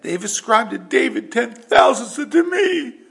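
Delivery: high, loud whiny complaint; mock crying. Jealous Saul complains about David getting ten thousands, crying. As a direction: wail it like a sore loser. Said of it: "And he's crying about it".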